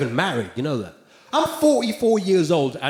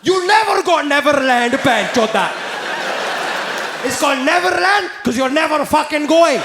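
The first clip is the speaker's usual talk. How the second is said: angry Indian dad; 6 semitones higher than usual. Retold or imitated. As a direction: scold the child in a thick Indian accent. Indian dad scolds kid.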